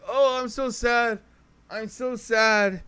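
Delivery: high, slow mocking whine of sad rappers. Streamer mocking how rappers sound, a high slow whine. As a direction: whine it back mockingly, drawn out. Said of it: "They were like".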